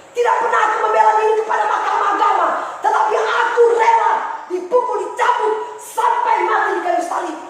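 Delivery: high, loud rebuking divine voice; voice of the Lord. Acted. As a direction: speak as Jesus rebuking the sulking servant. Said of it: "Tuhan bilang".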